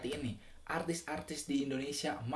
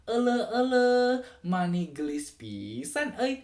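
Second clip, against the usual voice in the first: wavy Sundanese accent, high opening then stretched words; Sundanese lilt; 6 semitones higher, 6 dB louder than usual. Shown as a demonstration. Teacher showing viewers how Sundanese talk rolls, lilting Sundanese accent. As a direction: swing the tune up and down like a Sundanese speaker. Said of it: "dia ngomongnya tuh bergelombang".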